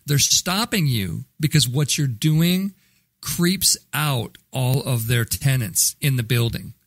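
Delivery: slow, deliberate spelling-it-out; slow it right down so they understand; patiently slowed explanation for a dense salesman; said slowly. Shown as a demonstration. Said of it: "let me say that again slowly so that you understand".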